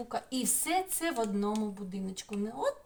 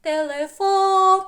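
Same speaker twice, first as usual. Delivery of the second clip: телефон slow and loud, higher and punched on фон; stress on the last syllable; 9 semitones higher, 12 dB louder, slower than usual. Shown as a demonstration. Teacher shows pupils where the stress falls in телефон. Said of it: "Який голосний найсильніше заспівав?".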